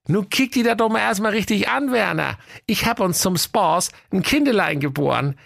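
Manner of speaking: a chirpy excited wife; Waltraud's voice. Acted. Wife showing her husband a bundle, high and eager.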